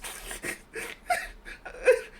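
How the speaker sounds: wheezing high-pitched laughter; high breathless laugh. Real, not acted. Laughing at her own advice to her friend, helplessly. Laughing fit mid-sentence.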